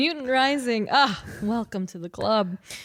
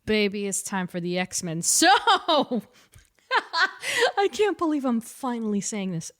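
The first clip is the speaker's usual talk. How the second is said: ordinary delivery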